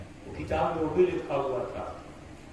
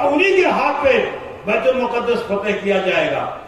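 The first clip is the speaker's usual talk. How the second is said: high, loud proclamation; loud prophecy; 7 semitones higher, 11 dB louder than usual. Acted. Old man foretells conquest, loud and high. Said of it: "کہا".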